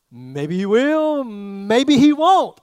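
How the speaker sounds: slow, teasing, stretched-out hedge; slow teasing shrug of a reply; teasing drawn-out maybe. Acted. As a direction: drag it out playfully to tease the crowd.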